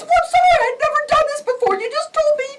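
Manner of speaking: very high, loud, fast panicked excuse; frantic apology. Retold or imitated. Mother to officer, flustered and panicked.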